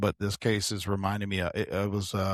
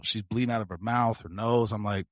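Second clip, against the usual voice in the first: ordinary talk, nothing marked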